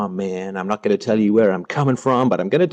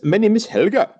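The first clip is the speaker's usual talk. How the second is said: Helga's line said much higher; mock Helga; 10 semitones higher than usual. Retelling a comedy movie scene to chat, in a high mock Helga voice. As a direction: pitch it up high for the movie woman. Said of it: "she says".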